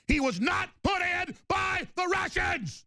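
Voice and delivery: indignant broadcast yell; furious shout. Real, not acted. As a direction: slam the point home at full volume. Host's tirade peaks in a shout.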